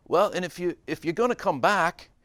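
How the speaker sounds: put-on high, loud English accent; hammed-up English accent. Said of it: "he had an English accent".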